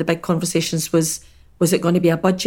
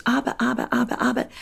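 mocking string of excuses, pitched up; objecting neighbour; 6 semitones higher than usual. Neighbour fending off advice about Naples, high mock protests. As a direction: imitate her protesting in a high, repetitive babble. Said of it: "You just go, Abbott".

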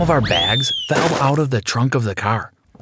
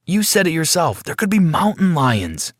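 higher-pitched, argumentative objection; protesting; 7 semitones higher than usual. Acted. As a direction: argue back with raised pitch. Jack argues against going.

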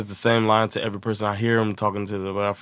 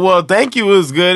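higher, louder indignant retort; indignant sister; 9 semitones higher, 8 dB louder than usual. Retold or imitated. Sister telling the beggar a thank you will do. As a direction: snap back, mildly offended. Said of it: "she was like".

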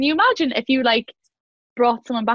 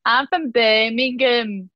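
slow, stretched-out Birmingham impersonation; mock Birmingham accent; slower than usual. Mocking everyone who tries the accent, slow exaggerated Birmingham. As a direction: drag out a parody Birmingham accent. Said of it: "when they do the accent".

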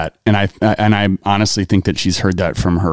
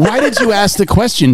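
higher, bewildered mock-indignant question; mock exasperated dad; 10 semitones higher than usual. Dad asking his son why he asked, mock indignant. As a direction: ham up the annoyed father. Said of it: "And I'm like".

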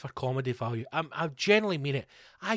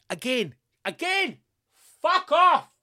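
high, rising exasperated yell; exasperated outburst; 9 semitones higher, 3 dB louder, slower than usual. Real, not acted. Comic reacts to another useless tip, exasperated outburst. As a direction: build to a high, loud burst of outrage.

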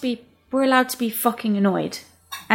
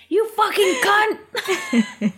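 slow, higher, laughing pretend rage; mock furious yell, laughing; 9 semitones higher, much slower than usual. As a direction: ham up a tabletop-flipping curse. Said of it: "Ready to flip the table at a dead guy".

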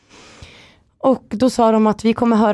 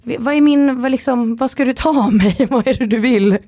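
flustered panic; 6 semitones higher than usual. Retold or imitated. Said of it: "Jag bara".

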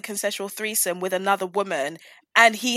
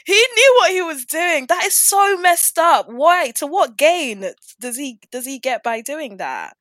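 outraged; 7 semitones higher, 7 dB louder than usual. Real, not acted. Continues outraged rant.

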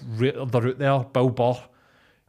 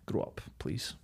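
muttered; 4 semitones lower, 14 dB quieter than usual. Pretend. Repeats the quote, very quiet.

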